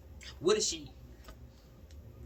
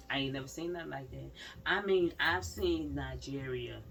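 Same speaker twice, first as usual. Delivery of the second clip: ordinary talk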